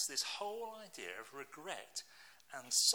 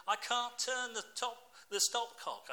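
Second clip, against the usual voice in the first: wife's reply; 9 semitones higher, 6 dB louder than usual. Retold or imitated. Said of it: "She said".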